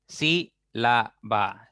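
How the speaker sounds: "Sílaba" very slowly as sí - la - ba; syllable by syllable. Shown as a demonstration. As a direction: spread "Sílaba" over three separate beats, sí, la, ba. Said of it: "Ya tienen tres sílabas".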